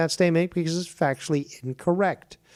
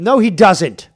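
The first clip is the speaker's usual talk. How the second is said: raised, much louder denial; real argumentative objection; loud contradiction; 5 semitones higher, 9 dB louder than usual. Real, not acted. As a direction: cut in forcefully to reject it.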